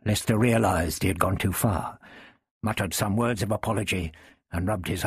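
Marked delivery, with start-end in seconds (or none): none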